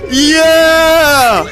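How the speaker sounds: high, loud victory shouts; ecstatic cheering. Real, not acted. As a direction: scream with joy. Celebrating a hundred.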